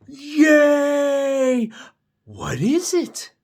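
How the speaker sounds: high, loud cheer and eager question; excited. Acted. Gerald hearing of a surprise, loud excited squeal. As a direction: burst out high and loud with delight. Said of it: "Look at how excited Gerald is".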